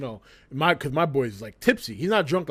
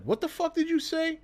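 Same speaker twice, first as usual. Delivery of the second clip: angry challenge; 11 semitones higher, 6 dB quieter than usual. Retold or imitated. Angry guy confronting him.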